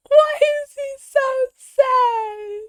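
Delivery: high, slow pleading whine for the cat; mock pitying whine. Streamer to the sad cat on screen, high slow pitying whine. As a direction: stretch it high like cooing at a sad pet.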